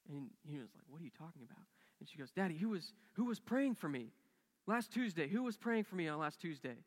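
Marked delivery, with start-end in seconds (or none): 0.9-1.7 very soft, stunned question; stunned disbelief. Retold or imitated. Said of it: "he's so, like, in disbelief and shock". 2.3-6.8 loud, high-pitched urgent question; insistent pleading. Retold or imitated. Daughter urgently questions her father.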